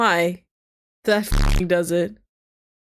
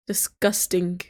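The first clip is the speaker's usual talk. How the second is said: normal talking voice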